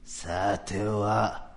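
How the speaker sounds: usual speaking voice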